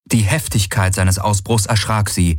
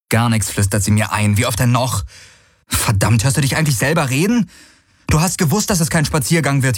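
exasperated, fast, rising to a higher pitch; Galen irritated; 6 semitones higher, faster than usual. Acted. Galen brushing off Kirai's worries, exasperated. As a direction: snap back at her, impatient.

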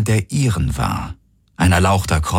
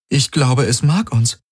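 higher, wondering voice; tentative realisation; 7 semitones higher than usual. A narrator's reading. Kirai voicing a crazy idea about the ship, tentative and high. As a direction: venture it hesitantly, pitched up. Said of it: "Sie kam sich verrückt dabei vor".

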